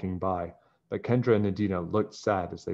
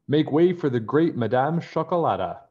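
loud, higher, grand announcement; heralding a grand entrance, louder and higher; grand declaration; 6 semitones higher, 6 dB louder than usual. A narrator's reading. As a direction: announce her like royalty arriving. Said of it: "a voice declared".

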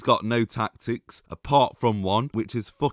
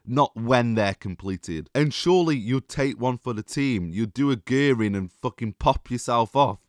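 ordinary delivery

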